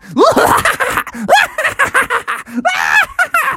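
high, long villain cackle; evil laugh. Acted. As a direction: cackle wickedly in long bursts. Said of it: "an evil laugh".

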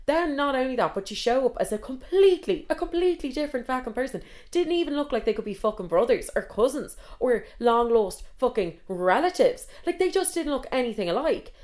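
high-pitched, emphatic indignation; exasperated rant. Retold or imitated. Storyteller ranting about the catfish's looks, exasperated. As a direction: pile up the disbelief, voice climbing. Retold rage at a different person showing up.

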